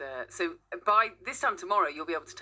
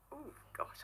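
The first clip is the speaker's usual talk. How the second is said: whispered thought; 9 semitones higher, 15 dB quieter than usual. Retold or imitated. Imitated inner thought.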